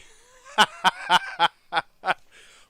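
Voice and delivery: big, high burst of laughter; loud laughing. Real, not acted. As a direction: crack up loudly at your own joke. Cracks up at own accent.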